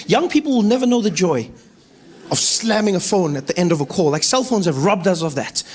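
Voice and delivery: normal talking voice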